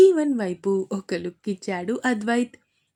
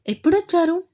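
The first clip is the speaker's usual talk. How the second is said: surprised question; 8 semitones higher, 6 dB louder, faster than usual. Acted. Surprised he is back.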